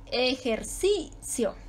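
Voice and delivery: Ejercicio slowly, e-jer-CI-cio with long CI; syllable-by-syllable Ejercicio, CI held; stressed CI. Shown as a demonstration. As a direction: stretch the first CI of Ejercicio. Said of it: "Ahí está la sílaba tónica".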